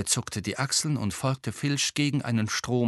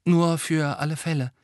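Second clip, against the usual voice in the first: put-on higher-pitched, uneasy girl; mildly jittery, voice pitched up; nervous; 8 semitones higher, 3 dB louder than usual. A narrator's reading. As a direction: say it anxiously, keen to get away. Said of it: "sagte Hermine nervös".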